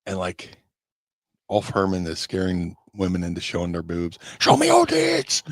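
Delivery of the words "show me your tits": put-on high shouted German-accented yell; heavy German accent. Wrestler yelling at women from a parade float, heavy German accent. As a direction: bark it out in a thick German accent. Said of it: "in this heavy German accent".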